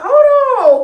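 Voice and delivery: sharp high startled call; a sudden high yelp of alarm; hold on. Retold or imitated. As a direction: blurt it out high and loud. Startled call mid-fight.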